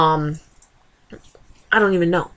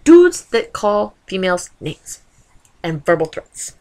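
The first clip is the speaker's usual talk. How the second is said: ordinary delivery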